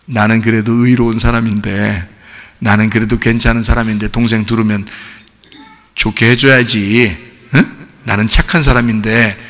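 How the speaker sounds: low, soft inner voice; self-righteous musing. Acted. Churchgoer convinced of being a good person, self-righteous musing. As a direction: murmur it low, as if to yourself. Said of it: "착하니 컴플렉스에 걸린 거죠".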